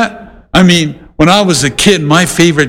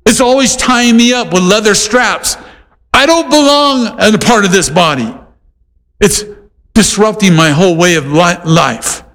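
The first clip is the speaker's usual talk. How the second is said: higher, complaining voice of a foot; grumbling foot; 4 semitones higher than usual. Pretend. A foot complaining about the hand, higher and whiny. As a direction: whine like a body part fed up with the hand. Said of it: "Paul gives an example of what it could say".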